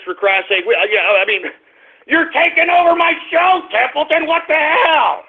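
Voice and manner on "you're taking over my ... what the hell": higher pitched, fed-up shout at the dog; real annoyance, voice jumps up; exasperated scolding. Real, not acted. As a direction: lose patience with the dog mid-show. Host scolding dog on air.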